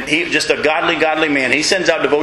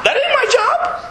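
breezy shrug; slower than usual. Acted. Mock carefree answer to worry.

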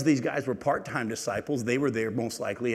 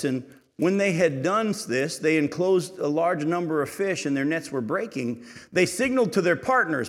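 higher, louder proclaiming Bible reading; scripture reading; 6 semitones higher, 5 dB louder than usual. Acted. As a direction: proclaim the passage to the whole church.

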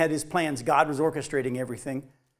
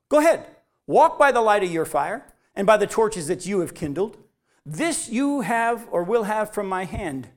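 fiery preacher reading Isaiah; preaching scripture; 3 semitones higher, 5 dB louder than usual. Acted. Reads scripture with force.

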